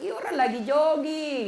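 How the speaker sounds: mock wife's reply. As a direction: toss off the answer like a carefree wife.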